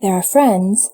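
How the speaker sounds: plain reading voice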